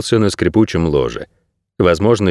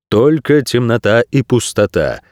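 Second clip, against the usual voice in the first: ordinary delivery, nothing marked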